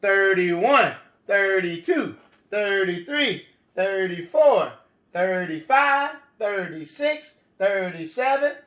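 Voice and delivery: put-on reading to a toddler. Counting aloud for children.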